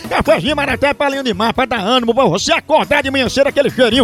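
lively radio ad read. Acted. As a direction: sell the coffee brightly. Reads a coffee advert. (leitura de comercial de rádio)

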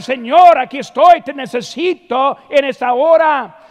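loud, high pleading cry to the Lord; crying out in prayer. Shown as a demonstration. Preacher shows the congregation a prayer at full lungs, loud pleading. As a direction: call out with full lungs, desperate. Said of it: "o llorar y orar".

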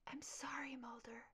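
weak, fading whisper; barely audible apology; faint whisper. Acted. Dying partner whispers apology.

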